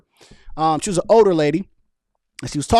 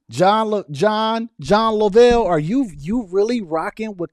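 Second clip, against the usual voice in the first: calling out; 5 semitones higher, 3 dB louder, slower than usual. Real, not acted. Calling out a chat member.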